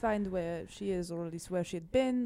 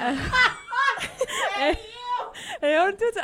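laughing; 13 semitones higher, 8 dB louder, much slower than usual. Acted. Laughing through character line.

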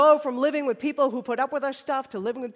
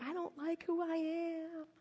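soft, slow, higher-pitched lament; quiet inner dismay; 4 semitones higher, 12 dB quieter, slower than usual. Acted. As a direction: say it small and slow. Voices a test taker's inner thought.

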